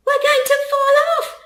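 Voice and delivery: high, fast, loud panicked yell. Acted. Charlie on the swooping goose, yelling high and fast in fright. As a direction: shout it in terror, rushing. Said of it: "Charlie yelled".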